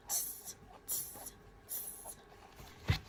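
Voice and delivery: hissy "tss" monkey taunt; short voiceless tss sounds, one by one; teasing little monkey tss; monkey noise. A narrator's reading. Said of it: "monkeys only shook their hands back".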